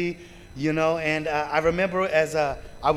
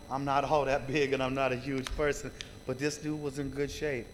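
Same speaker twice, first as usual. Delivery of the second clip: ordinary talk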